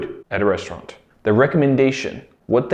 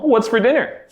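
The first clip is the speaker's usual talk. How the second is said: put-on kid asking mom; 8 semitones higher than usual. You can ask Mom.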